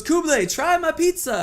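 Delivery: high, loud mock-Italian voice; Italian accent. Rival pizzeria owner barging in with his pizza, hammed-up Italian accent. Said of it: "he has an Italian accent".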